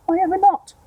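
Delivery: very high, loud protest; indignant squawk. Acted. Aunt protests to her sister, indignant high squawk. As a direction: squawk it out, outraged.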